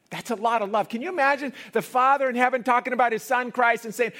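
high, loud, driving preacher; full-force preaching. Acted. Preacher to congregation, loud and high. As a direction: pound out God's love to the crowd.

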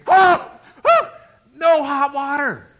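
loud, high yelps, then drawn-out words; hammed-up shocked cry; cold-shower shock. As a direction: yelp as if hit by icy water.

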